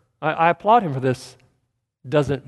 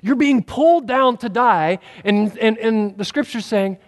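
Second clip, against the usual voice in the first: usual speaking voice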